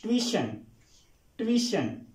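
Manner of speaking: ट्यूशन run together as TWEE-shun; quick TWEE sound. Shown as a demonstration. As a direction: say ट्यूशन in one go, starting TWEE. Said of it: "फास्टली इसको". (ट्यूशन को झट से 'ट्वीशन')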